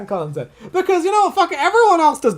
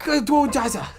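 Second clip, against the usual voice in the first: Jazza impression; 4 semitones higher than usual. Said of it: "this impression of Jazza when we were younger".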